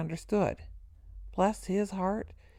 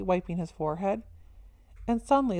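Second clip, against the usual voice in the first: ordinary delivery, nothing marked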